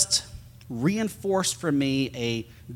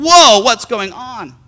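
high, loud and slow startled cry; retold panicked surprise; alarmed whoa; 9 semitones higher, 10 dB louder, slower than usual. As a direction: react as the van dies under you. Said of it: "and I'm like".

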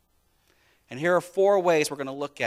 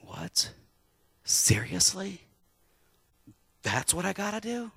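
shocked disbelief; 9 semitones higher than usual. Retold or imitated. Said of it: "imagine his jaw dropping".

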